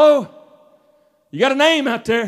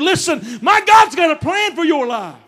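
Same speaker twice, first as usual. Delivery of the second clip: raised, driving sermon rhythm; full-force preaching; 4 semitones higher than usual. Acted. Preacher declaring God's plan to church, full-force preaching. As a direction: build fervently to a proclamation.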